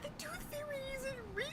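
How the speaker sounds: put-on tiny high kid voice, heartbroken in jest; squeaky child voice. Repeating the joke in a silly voice.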